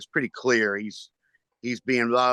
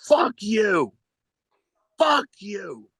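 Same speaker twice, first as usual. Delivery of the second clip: high-pitched yell imitating a heckler; retold yelling; 9 semitones higher, slower than usual. Guy at the bar heckles the comedian, retold yelling. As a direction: yell it like an angry man at the bar. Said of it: "he started yelling".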